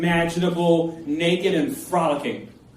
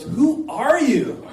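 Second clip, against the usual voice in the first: high, drawn-out question; mock incredulous girl; 8 semitones higher, 3 dB louder, much slower than usual. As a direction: stretch it out slowly, pitched way up. Girl accosts dancing stranger.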